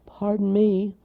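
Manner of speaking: small anxious creature voice; tight with fear. A narrator's reading. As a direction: squeak out a nervous apology. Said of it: "his voice tight with fear".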